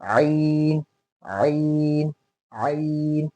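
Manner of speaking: आइन begun directly with a throat squeeze; straight throat onset. Shown as a demonstration. Teacher giving the correct version, आइन straight from the throat. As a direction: go straight into a long, constricted आइन. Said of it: "सीधा".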